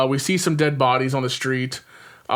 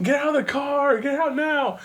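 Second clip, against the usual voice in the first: mock panicked yell; 12 semitones higher than usual. Panicked yelling quoted.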